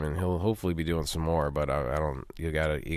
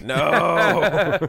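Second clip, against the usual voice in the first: laughing mock denial; 8 semitones higher, 6 dB louder than usual. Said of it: "You're like".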